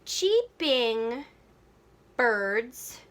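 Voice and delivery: drawn-out, halting beats; counting out syllables. Shown as a demonstration. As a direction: test each syllable with gaps between. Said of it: "let's count the syllables".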